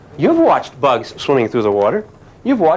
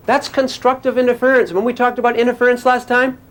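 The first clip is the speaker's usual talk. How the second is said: usual speaking voice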